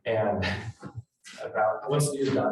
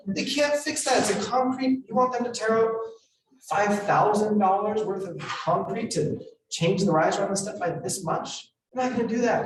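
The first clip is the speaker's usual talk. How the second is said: much higher, incredulous pleading; exasperated protest; 8 semitones higher than usual. Retold or imitated. Agent protests to his client about fixing the concrete step, exasperated and high. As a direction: push back in disbelief at the absurd request.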